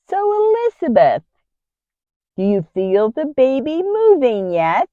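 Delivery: high-pitched nosy questioner; mock-curious question, pitched high; prying women.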